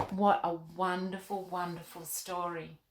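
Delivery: ordinary delivery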